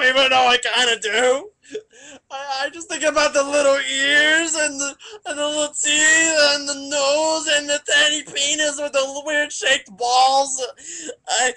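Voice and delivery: crying loudly through every line; loud blubbering. Acted. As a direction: wail it all out, hammed-up. Loud comic crying rant.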